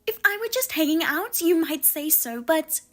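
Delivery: indignant sister-in-law. Acted. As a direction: play the offended sister-in-law taking offence.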